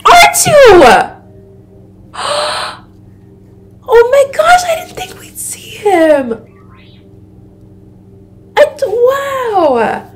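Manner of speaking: very high excited squeal; thrilled surprise. Real, not acted. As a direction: squeal in delighted shock, gasping. Thrilled by surprise character appearance.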